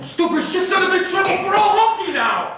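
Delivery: angry shout. Acted. Worker shouting his alarm to his friends. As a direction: yell it out in alarm. Angry outburst about the assassination.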